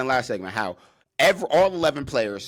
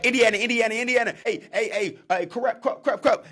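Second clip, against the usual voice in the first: high, loud chanting calls across the line; hyped sideline chant; shouted line calls; 9 semitones higher, 4 dB louder than usual. Retold or imitated. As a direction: bark the call like a lineman at the snap.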